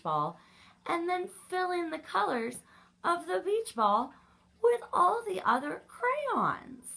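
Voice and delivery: high, loud indignant whine at the end. Acted. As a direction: protest that it isn't fair.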